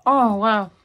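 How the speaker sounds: talking while chewing; chocolate in mouth; mouth full, chewing. Real, not acted. Seen 1: Bites at -3 to -1; cheek bulges and chews through 1.2s.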